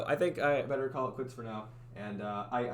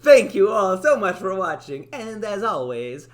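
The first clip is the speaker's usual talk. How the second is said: 1 Italian accent sign-off; 6 semitones higher, 11 dB louder, much slower than usual. Shown as a demonstration. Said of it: "go a full Italian".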